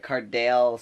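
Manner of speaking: "Cardale" ending like "dale"; mispronounced name. Retold or imitated. Substitute taking roll, "Cardale" with long a. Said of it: "she pronounced it Cardale".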